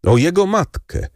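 usual speaking voice

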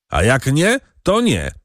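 high-pitched, mildly shouted defiance; defiant cry. A narrator's reading. Second farmhand challenges the ordynat, defiant cry. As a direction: toss it out high and bold. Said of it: "krzyknął drugi rozrzutnie".

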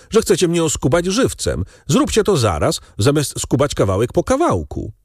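high-pitched complaint with a note of bitterness; bitter protest. A narrator's reading. Captive Danglars accuses the bandits of fleecing him, bitter protest. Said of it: "krzyknął Danglars z nutą goryczy".

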